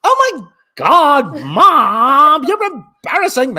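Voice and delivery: put-on high, loud Valley Girl teen whine; drawn-out Valley Girl protest; Valley Girl accent. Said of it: "have a Valley Girl accent".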